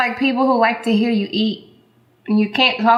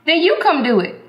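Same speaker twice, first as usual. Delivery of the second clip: annoyed; 6 semitones higher than usual. Real, not acted. Snapping back at husband.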